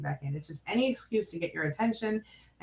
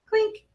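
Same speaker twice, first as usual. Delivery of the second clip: clink; 7 dB louder than usual. Pretend. Reading a coffee cup comment.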